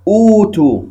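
أوتوا said with a long open u, no hamza; long vowel demo. Shown as a demonstration. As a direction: stretch أوتوا as one smooth long u. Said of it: "أصل هذه الكلمة أؤتوا". (نطق أوتوا بمد الواو)

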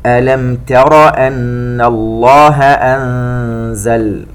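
slow chanted tajweed recitation; Quran recitation. Acted. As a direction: draw out each vowel, chant it. (تلاوة مرتلة بطيئة)

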